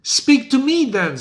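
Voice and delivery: a lightly voiced fearless Banquo; bold Banquo. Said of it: "says Banquo".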